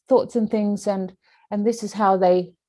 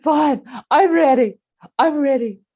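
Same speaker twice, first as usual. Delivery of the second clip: exasperated giving in; 7 semitones higher, 5 dB louder than usual. Acted. Person gives in before dawn.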